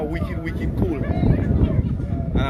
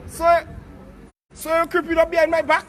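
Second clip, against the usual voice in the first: high-pitched, rising challenge; mock-indignant questioning, voice raised high; mock confrontation; 6 semitones higher than usual. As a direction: playfully accuse them, pitched well up. Mock confrontation of someone behind him.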